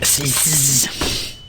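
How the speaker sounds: soft voiceless sibilant hiss; snake hissing. Pretend. Man introducing his pet snake, soft hissing. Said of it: "my snake".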